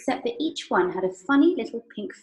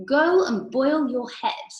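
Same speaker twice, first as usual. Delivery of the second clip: very slow, high and louder; Mister Wonka's voice; 6 semitones higher, 6 dB louder, slower than usual. Acted. Mister Wonka teasing Missus Salt, slow, high and louder. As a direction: draw it out, mock-grand.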